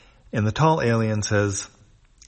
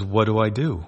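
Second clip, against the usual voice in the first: worried; 2 semitones higher, 4 dB louder than usual. A narrator's reading. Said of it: "She looks worried".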